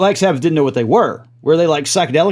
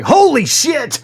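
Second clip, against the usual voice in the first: sudden high thrilled outburst; lighting crew's gleeful high yelp; excited exclamation; 10 semitones higher, much slower than usual. Retold or imitated. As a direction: blurt it out like the crew seeing the shot. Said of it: "They're like".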